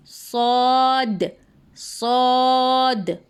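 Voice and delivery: सौद drawn out slow with a full, heavy mouth; heavy full-mouth saad. Shown as a demonstration. Teacher showing sisters the heavy सौद, slow and full. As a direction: fill the mouth and stretch सौद. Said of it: "मुंह को पुर यानी मुंह को भर के पढ़ेंगे".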